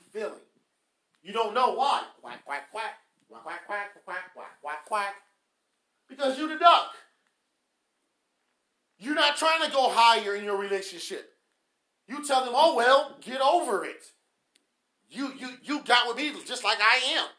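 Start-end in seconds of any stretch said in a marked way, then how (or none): 2.2-5.2 quick run of imitated duck quacks. Speaker mocking a spouse who is the duck, duck quacking. 6.1-17.3 loud, hard-hit exhortation; forceful preaching. Real, not acted. Preacher calling out spouses who refuse to grow, loud preaching.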